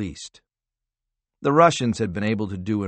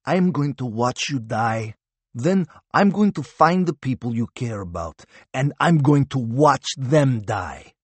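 menacing Russian captive; 4 semitones higher than usual. A narrator's reading. Captive Russian threatens Harvath.

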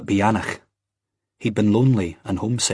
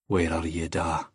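low, subdued plea; quiet, understated anguish; 3 semitones lower, 5 dB quieter than usual. A narrator's reading. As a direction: call inwardly to a lost father. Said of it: "he thought in anguish".

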